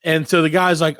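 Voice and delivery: ordinary talk, nothing marked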